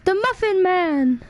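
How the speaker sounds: slow, loud, high shouted line; hammed-up movie shout; excited shout. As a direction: bellow it like the film.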